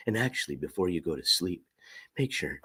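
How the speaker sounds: deep soft murmur, lulling; low ASMR voice. Shown as a demonstration. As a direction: hush it low for sleepy listeners. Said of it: "I don't have the best A S M R voice".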